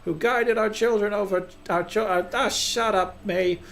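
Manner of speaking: high, theatrical speech with flustered detours; blustering council head. Acted. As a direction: proclaim grandly in a high put-on voice.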